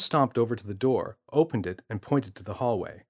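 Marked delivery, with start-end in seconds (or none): none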